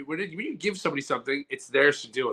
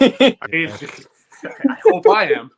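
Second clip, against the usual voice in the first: high, slow laughing reply; 8 semitones higher, 9 dB louder, slower than usual. Real, not acted. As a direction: laugh it out nervously.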